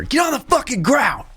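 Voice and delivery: high, barking police command, mock yelled; retold cop yell. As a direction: bark it like a cop drawing down on you. Cop shouts order at him.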